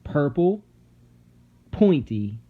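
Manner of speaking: ordinary delivery, nothing marked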